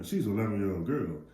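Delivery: ordinary delivery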